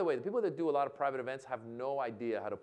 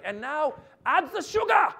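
put-on high, loud German-accented order; yelling German chef; 13 semitones higher, 10 dB louder than usual. German chef to his kitchen workers, yelled order. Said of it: "As the German chef yells orders".

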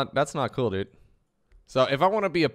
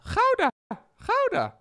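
very high falsetto exclamation; catchphrase squeal; slower than usual. Shown as a demonstration. Streamer testing his own VTuber sound, high falsetto. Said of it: "properly pronounced".

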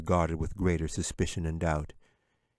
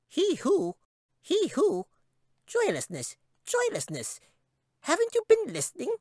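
very high shrill squeak, impatient; tiny creature losing patience; exasperated squeaky chipmunk. A narrator's reading.

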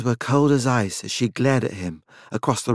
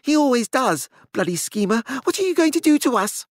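high, fast panicky plea; calm gone, voice fraying; ragged, unsteady; 13 semitones higher than usual. A narrator's reading. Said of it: "His voice was ragged and unsteady".

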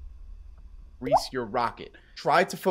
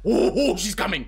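sudden panic; 5 semitones higher, 6 dB louder than usual. Real, not acted. Mom approaching, panic.

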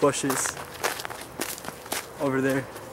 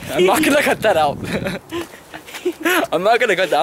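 laughing; 6 semitones higher, 3 dB louder than usual. Real, not acted. Laughing refusal to edit out.

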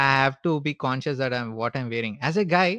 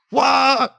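sudden high yelp of pain as the mask rips; yelping out loud at the sting; pained wow; 10 semitones higher, 7 dB louder than usual. Retold or imitated. Girlfriend rips charcoal mask from mustache, high pained yelp. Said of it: "and I was like".

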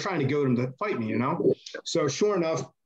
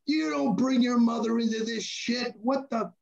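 much higher, outraged shouting; hammed-up furious yell at Chad; mock outraged yelling; 10 semitones higher than usual.

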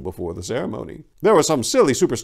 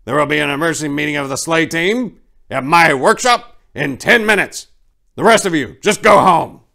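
loud, higher commanding voice; stern loud order; 6 semitones higher, 8 dB louder than usual. Acted. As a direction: lay down the law to the crowd. Santa orders the unruly crowd.

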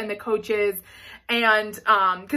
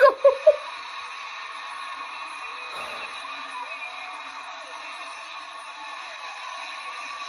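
long, very high, breathless laugh-cry; overwhelmed squeal of tearful joy; laughing sob; 5 dB quieter than usual. Real, not acted. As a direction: lose it between laughing and crying. Overcome by the routine.

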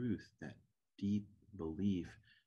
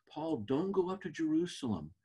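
higher, louder urgent plea; pleading; 9 semitones higher, 4 dB louder than usual. Retold or imitated. Disciples begging Paul to stay away, urgent pleading cry. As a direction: beg him not to go. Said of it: "we pleaded with Paul".

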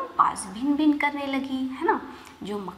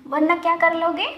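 high, loud storybook defiance; defiant fly; 7 semitones higher, 6 dB louder than usual. A narrator's reading. Little fly daring the angry lion, defiant. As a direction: pitch it up as a cheeky challenge.

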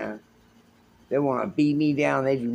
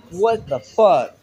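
drawn-out, louder stunned what the fuck; stunned disbelief; 5 semitones higher, 6 dB louder, slower than usual. Real, not acted. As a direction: let it out slowly in shock, louder. Stunned at door opening.

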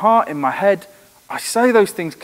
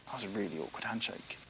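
much softer, quick muttered thought to yourself; inner thought; 7 semitones lower, 20 dB quieter, faster than usual. Retold or imitated. Person leaving an awkward handshake, softly to themselves. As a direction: think it under your breath. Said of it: "walk away from that handshake thinking".

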